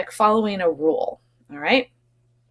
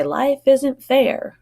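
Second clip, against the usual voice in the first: slow, higher, louder parent line; parent saying; 6 semitones higher, 3 dB louder, much slower than usual. Retold or imitated. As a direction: quote a parent, drawn out and a bit louder. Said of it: "you may have heard your parents say".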